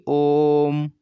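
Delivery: ओम sung out slowly with a long ओ; prolonged Om. Shown as a demonstration. Teacher showing pluta swar, stretching ओम. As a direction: draw out ओम, ओ held three beats. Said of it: "वो तीन गुने खिंचनी चाहिए".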